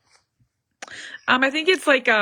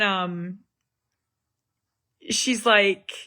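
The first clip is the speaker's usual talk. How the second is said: ordinary delivery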